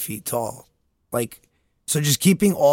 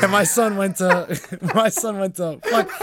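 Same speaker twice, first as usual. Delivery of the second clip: high, breaking up with laughter; laughing imitation; 7 semitones higher, 4 dB louder than usual. Imitating the dad boasting about his son, laughing. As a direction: mimic the dad, cracking up. Mimics dad while cracking up.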